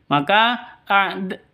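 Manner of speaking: usual speaking voice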